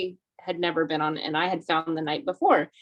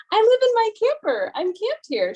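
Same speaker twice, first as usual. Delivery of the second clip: very high, light imitation of the lady; imitating the camper lady's high voice; casual answer; 13 semitones higher, 5 dB louder than usual. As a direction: mimic her breezy answer. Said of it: "She's like".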